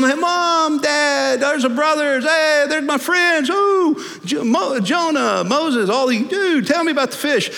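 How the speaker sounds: very high, loud, giddy delight; hammed-up squeal of happy reunion; excited greetings. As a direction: hail long-lost loved ones, overjoyed. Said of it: "He's having a great time".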